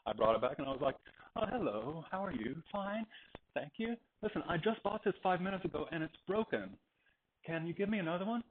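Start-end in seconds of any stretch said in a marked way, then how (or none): 1.4-8.4 high-pitched, polite request; polite customer. Retold or imitated. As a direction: put on a higher, courteous customer voice. Acts out polite customer asking.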